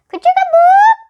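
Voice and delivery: very high, loud mechanical "Ka-chugga-boop!" rising; loud bell sound. A narrator's reading. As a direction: belt out "Ka-chugga-boop!" like a strange alarm bell. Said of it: "a very loud".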